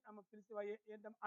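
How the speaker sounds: low, very soft, deferential answer; gentle reply. Acted. Son comes close to answer mother's call, gently and softly. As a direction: answer meekly, barely voiced. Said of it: "లేదంటే దగ్గరకు వచ్చి".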